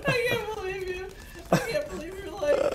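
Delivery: high, squeaky laughing voice; helpless laughter. Real, not acted. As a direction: barely get it out through laughter. Cracking up while teasing friend.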